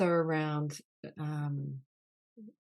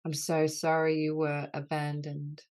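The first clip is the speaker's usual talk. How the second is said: healing litany; 4 dB louder than usual. Acted. Leader voicing apologies to the wounded inner child, earnest and grave. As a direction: lead the group through each sorry with tender gravity.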